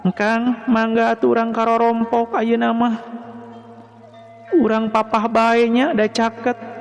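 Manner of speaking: high-pitched feminine voice; young woman character, inviting. A narrator's reading. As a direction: invite politely in a high, even lilt. Narrator plays Neng Jejeh inviting Wahyu. (sora istri luhur keur ngajak)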